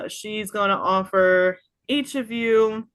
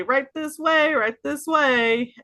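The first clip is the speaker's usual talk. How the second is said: higher, loud fussy ushering; eager dwarf; 9 semitones higher, 4 dB louder than usual. Acted. Dwarf ushers guests to the kitchen, raised and bustling. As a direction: hurry them along like a busy host. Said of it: "uh, say".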